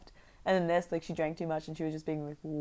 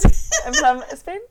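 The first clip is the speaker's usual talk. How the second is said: loud, high mock Spanish accent; playful Spanish accent impression; 10 semitones higher, 12 dB louder, much slower than usual. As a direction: imitate a Spanish accent through laughter. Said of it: "same accent as my friend who's from Spain".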